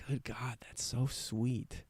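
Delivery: very soft, awed murmur; quiet, almost to yourself; hushed. Real, not acted. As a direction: murmur it under your breath, overcome. Moved by the beauty around them.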